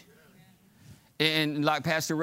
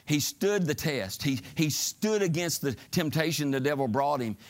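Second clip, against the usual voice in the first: ordinary delivery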